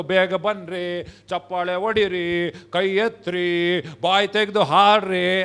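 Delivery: slow, drawn-out pleading call; mock coaxing. Leader prodding reluctant worshippers to join in, mock coaxing. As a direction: stretch each order's last syllable, imitating a nagging leader. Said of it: "ಸ್ವಲ್ಪ ಜನ ಹೇಳ್ಬೇಕು".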